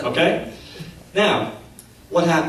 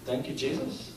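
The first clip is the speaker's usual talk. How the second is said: meek repeat; 4 semitones lower, 13 dB quieter than usual. Retold or imitated. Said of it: "He goes".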